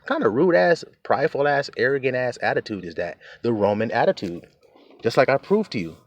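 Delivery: ordinary talk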